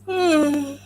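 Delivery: long sleepy yawn; big yawn. Real, not acted. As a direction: yawn out loud, just woken up. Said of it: "you heard me yawn".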